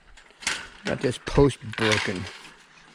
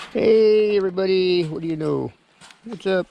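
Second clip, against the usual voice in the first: bright high hello to animals; cheery greeting; 7 semitones higher than usual. Real, not acted. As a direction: sing out hello to the herd. Greeting the goats.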